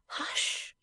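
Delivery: soft whispered shush; tender, hushed whisper; gentle hush. A narrator's reading. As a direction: whisper it to silence a loved one. Wife silences husband, hand on lips.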